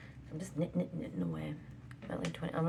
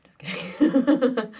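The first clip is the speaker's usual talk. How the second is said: laughing; 7 semitones higher, 12 dB louder, much slower than usual. Real, not acted. Laughing retraction.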